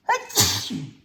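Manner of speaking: loud, high "achoo"; acted sneeze. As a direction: put on a big story sneeze. Frog with tickled nose sneezes.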